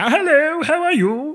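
very high squeaky put-on greeting. Co-host showing off a silly voice for laughs, very high squeaky greeting. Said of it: "I'm putting a voice on now".

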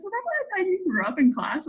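high-pitched mock reminder; playful high retelling of her tease; imitated teasing teacher. Teacher's teasing reminder, voiced in story.

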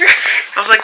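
ordinary talk, nothing marked

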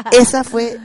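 risa fuerte y aguda que corta la frase; carcajada real. Real, not acted. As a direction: se ríe a carcajadas al cerrar la historia. Carcajada al terminar la historia. (risa real al terminar de contar su historia)

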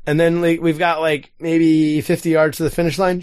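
ordinary delivery

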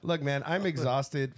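ordinary talk

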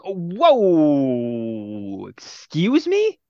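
hammed-up disbelief, stretched out; shocked reaction. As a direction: play a stunned double take. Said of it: "it's just like".

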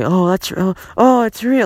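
high, mocking gasp at fans who believe it; mocking gullible fans. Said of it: "when people say".

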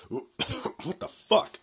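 coughing, water down the wrong pipe; choking on a drink. Real, not acted. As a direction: choke and sputter through it. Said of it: "Something in my throat".

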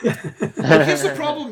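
laughing. Real, not acted.

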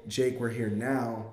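usual speaking voice

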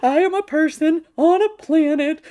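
high, loud mock-overwhelmed cry; hammed-up existential overwhelm. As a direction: play up being swamped by it all. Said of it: "I just get this overwhelming sense".

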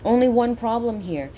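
ordinary talk

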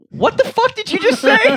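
high, loud incredulous squawk; hammed-up shocked yelp; mock outrage. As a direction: squeal it in fake disbelief. Mock outrage at awkward remark.

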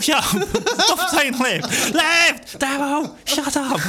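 high, laughing exasperated shouts; hammed-up exasperated scolding with laughs; mock annoyed family. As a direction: imitate the passengers telling him off. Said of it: "And they go".

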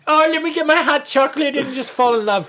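high-pitched mock gushing; gushy, heartwarming. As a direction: gush sweetly in a put-on high voice. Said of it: "it's the heartwarming, gushy, like".

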